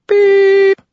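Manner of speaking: high electronic beep. A narrator's reading. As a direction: chirp like a machine tone. Voicemail beep.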